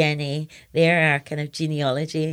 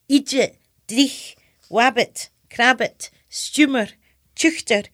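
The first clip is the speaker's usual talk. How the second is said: higher, louder broad Scots word list; Scottish dialect; 6 semitones higher, 5 dB louder, slower than usual. Acted. Poet reciting the lost words of her childhood, emphatic Scots dialect. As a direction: relish each Scots word, punched out one by one. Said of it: "Scottish dialect in it".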